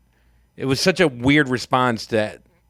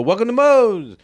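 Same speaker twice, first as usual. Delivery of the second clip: higher, louder, bright shop greeting; mock cheery greeting; 10 semitones higher, 5 dB louder than usual. Taco staff greeting customers, hammed-up cheery welcome. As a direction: sing it out like a counter worker. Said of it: "and they go".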